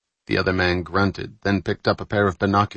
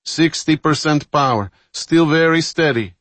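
engineer's raised, high-pitched report; calling out; 8 semitones higher, 5 dB louder than usual. A narrator's reading. Engineer calls power status to the captain, calling out high. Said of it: "the engineer called".

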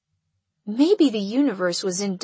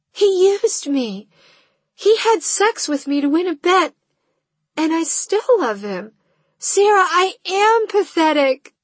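distraught; 9 semitones higher, 6 dB louder than usual. Acted. Confessing, distraught.

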